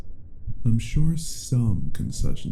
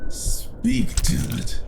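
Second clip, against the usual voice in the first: "Speak," flung out high and hard, then "damn it"; furious demand; 14 semitones higher, slower than usual. Acted. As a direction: patience snaps completely. Vampire loses patience with captive.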